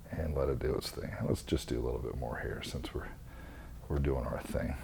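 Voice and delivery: hushed whisper, under the breath; barely audible focused whisper. Real, not acted. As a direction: whisper it while concentrating. Focused fine detail work.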